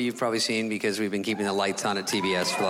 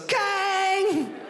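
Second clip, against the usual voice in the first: very high ringing "kang"; scale clang imitation. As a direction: imitate metal slamming on the scale. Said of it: "It goes".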